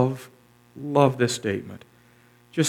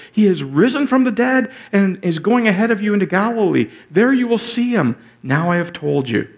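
raised, louder scripture proclamation; fervent preaching; 6 semitones higher, 4 dB louder than usual. Acted. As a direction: proclaim the angel's news with fire. Preaching the angel's words.

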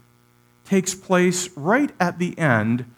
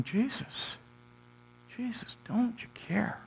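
fearful whisper; 9 semitones higher, 12 dB quieter than usual. Pretend.

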